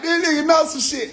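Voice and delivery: high, amazed exclamation; startled reaction. Retold or imitated. Speaker reacting to the app, surprised and high. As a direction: caught off guard, voice jumps up. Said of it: "I'm like".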